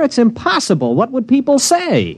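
rising high, scandalised; flustered protest. Acted. Man tells the girl she cannot stay, high and flustered. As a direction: push back, all in a fluster. Refusing to let her stay.

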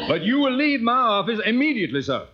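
high, quick Southern indignation; indignant order. Acted. Colonel throwing the impostor out, indignant. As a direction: order him out, voice rising high.

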